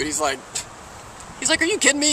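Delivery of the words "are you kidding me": high-pitched mock disbelief; incredulous outburst. Genie balking at the man's highway wish, incredulous. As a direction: blurt it out high, as if it's absurd.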